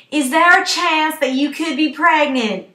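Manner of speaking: loud, high, slow acted interrogation; medical drill questioning. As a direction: question a patient loudly and very slowly. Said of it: "the medical history drill".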